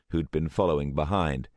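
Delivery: ordinary delivery, nothing marked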